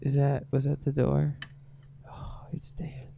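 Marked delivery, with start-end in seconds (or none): 2.1-3.1 hushed aside; whisper. Real, not acted. Friend whispering as the door opens, hushed. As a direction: whisper it so the newcomer can't hear.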